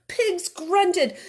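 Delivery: ordinary delivery, nothing marked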